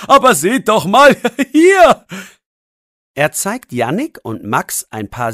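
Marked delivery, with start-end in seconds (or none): 0.1-2.1 laughing. A narrator's reading. Laughing man shows statue.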